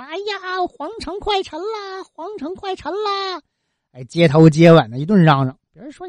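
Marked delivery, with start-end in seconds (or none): 0.0-3.4 high, strained mock street cry; monk crying out. Monk crying through the streets that the city will sink, high mock cry. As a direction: play a frantic monk wailing the warning, pitched way up. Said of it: "有个和尚在街上就乱嚷嚷说".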